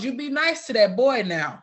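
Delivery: put-on Sister Boy calling out. Woman in the background to caller, imitated loud admonishing. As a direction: imitate her calling out a friendly warning. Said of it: "Sister Boy in the background".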